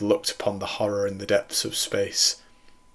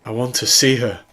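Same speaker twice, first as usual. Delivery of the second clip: louder, desperate wail; 3 semitones higher, 6 dB louder than usual. A narrator's reading. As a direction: plead for her in anguish. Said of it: "Doctor Jacobs wailed".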